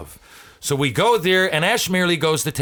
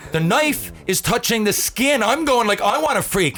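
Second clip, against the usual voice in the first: pitched way up, reliving the fear; panicked retelling; 8 semitones higher than usual. Retold or imitated. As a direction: voice climbing high as the knife touches skin. Panic at knife to throat.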